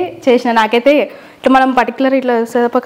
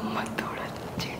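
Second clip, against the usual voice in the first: very soft, slower unvoiced whisper; hushed whisper; 21 dB quieter, slower than usual. Real, not acted. Prankster whispering to viewers after hiding the mic, hushed whisper. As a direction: whisper it so nobody overhears.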